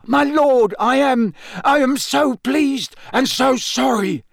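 high, loud, grovelling apology; gasping plea. Acted. Wormtail grovelling to his master on the floor, gasping. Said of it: "gasped the man on the floor".

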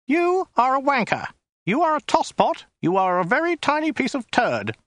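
high, prim, weaselly insults; small weaselly voice. Acted. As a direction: politely rattle off the insults like a fussy clerk. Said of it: "in a small, weaselly voice".

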